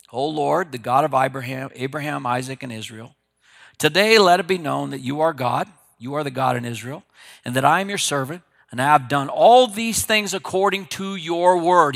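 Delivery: loud earnest prayer; fervent prayer. Acted. Elijah calling on God at the altar, fervent prayer. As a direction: pray out with conviction.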